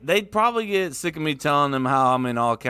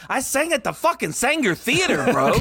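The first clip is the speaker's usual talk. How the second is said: mock boast; 12 semitones higher than usual. Boasting to brother, retold.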